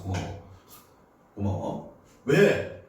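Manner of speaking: ordinary talk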